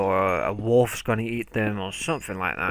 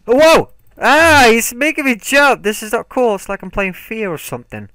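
very high, loud frightened cries; panicked yelling; 12 semitones higher, 11 dB louder than usual. Real, not acted. Gamer jump-scared by a mob, yelling high. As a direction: yelp and keep yelling in fright.

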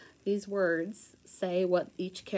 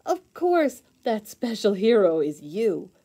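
big reveal; 9 dB louder than usual. Acted.

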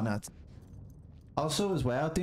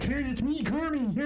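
high-pitched puppet frog impression; Kermit; 11 semitones higher than usual. Shown as a demonstration. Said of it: "Don't be like".

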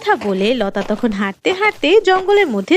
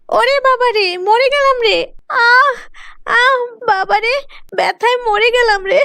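screaming in pain; 8 semitones higher than usual. Acted. Girl slips while washing clothes, screaming in pain. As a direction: cry out after slipping and falling hard. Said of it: "চিৎকার দিয়ে বলে উঠল".

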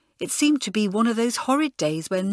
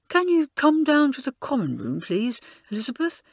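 higher, louder, uneasy plea; Jenny fetching Elizabeth, uneasy and higher; unhappy; 6 semitones higher, 4 dB louder than usual. A narrator's reading. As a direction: ask it unhappily, pitched up. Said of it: "she asked unhappily".

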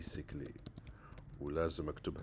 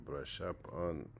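slow model phrase; 3 semitones lower, 5 dB quieter, much slower than usual. Shown as a demonstration. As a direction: brush up on said very slowly, falling on on.

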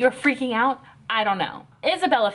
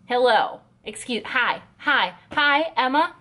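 normal talking voice

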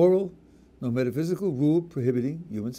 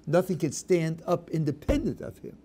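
normal talking voice

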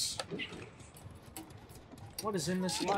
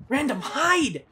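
louder, higher repeat of the call; urgent shout; 6 semitones higher, 8 dB louder, slower than usual. Real, not acted. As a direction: yell it at a teammate who must hide now. Urging teammate again, louder.

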